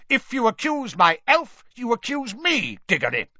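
high, forceful, angry warning; shouting Mister Crouch. A narrator's reading. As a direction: shout it, dropping on the surname. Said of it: "shouted Mister Crouch".

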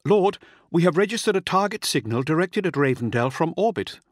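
high-pitched urgent controller; hurried report. A narrator's reading. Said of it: "the controller said hurriedly".

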